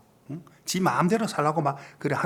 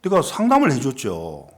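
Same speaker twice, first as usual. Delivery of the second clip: normal talking voice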